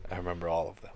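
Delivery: low, very soft acted answer; hushed reply. Co-host playing Bucky's reply about remembering, hushed. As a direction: answer the whisper in a low hush. Answers the whispered quote in role.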